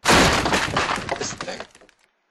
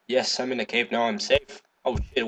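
ordinary talk, nothing marked

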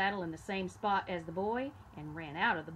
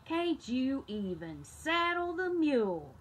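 slow, louder annoyed farmer; exasperated farmer; 5 semitones higher, 4 dB louder, slower than usual. A narrator's reading. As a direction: scold the boy, dragging it out. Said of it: "asked the farmer".

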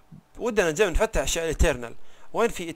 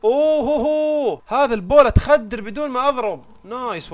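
drawn-out whoop, much higher and louder; ecstatic whoop; 8 semitones higher, 8 dB louder, slower than usual. Real, not acted. Gamer cheering as the bola tames the bird, stretched ecstatic whoop. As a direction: cheer slowly like you just won.

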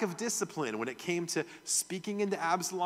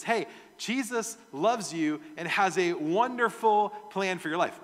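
upbeat preacher; 5 semitones higher, 5 dB louder than usual. Retold or imitated. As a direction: sell the happy message.

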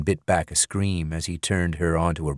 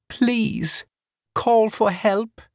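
higher, frightened woman's voice; pleading woman; 13 semitones higher, much slower than usual. A narrator's reading. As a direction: beg weakly for help.

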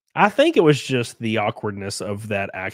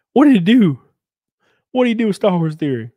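loud, high-pitched demand to know; startled questioning; 9 semitones higher, 9 dB louder than usual. Real, not acted. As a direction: blurt it out loudly, pitched well up. Reacting to puzzling chat message.

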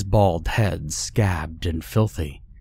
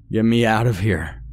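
restrained narrated scream, pitched higher; screamed plea; 4 semitones higher, 4 dB louder than usual. A narrator's reading. Captive left chained and vomiting, a mild screamed plea. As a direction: cry out for help, only half at full force. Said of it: "I screamed".

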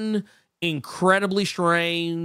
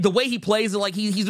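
ordinary talk, nothing marked

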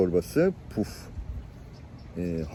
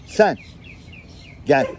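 calling out from stage. Retold or imitated. [name] calls him out.

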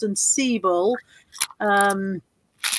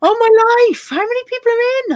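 high, loud amazed outburst; astonished exclamation; 11 semitones higher, 5 dB louder than usual. Real, not acted. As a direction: exclaim in overwhelmed disbelief. Overwhelmed by number of viewers.

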